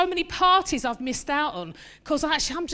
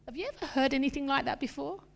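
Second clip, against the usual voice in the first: normal talking voice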